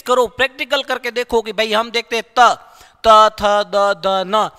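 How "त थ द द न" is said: त थ द न said firmly with the tongue on the teeth; teacher demonstrating dental consonants; tooth sounds demo. Shown as a demonstration. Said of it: "हमारी जिह्वा दांतों को ही स्पर्श करती है".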